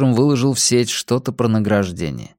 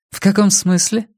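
high, questioning female character voice; puzzled woman; 9 semitones higher than usual. A narrator's reading. Woman querying Rex's remark, high questioning voice. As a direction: ask it lifted high, as the woman.

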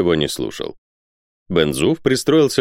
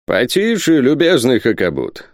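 raised, firm captain's tone; commanding; 6 semitones higher than usual. A narrator's reading. Captain silencing the ranting Hakhabut, commanding. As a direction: check him with authority. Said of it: "остановил его капитан Сервадак повелительным тоном".

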